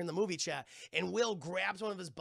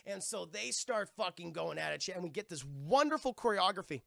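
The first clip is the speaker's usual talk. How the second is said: usual speaking voice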